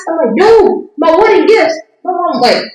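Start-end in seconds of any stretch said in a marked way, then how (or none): 0.4-1.7 high, exasperated mock wail; hammed-up exasperated protest; retold exasperated correction. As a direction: correct mom in high-pitched frustration, acting it out. Retold correcting mom on phone.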